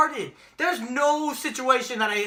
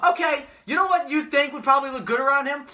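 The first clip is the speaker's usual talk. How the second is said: ordinary talk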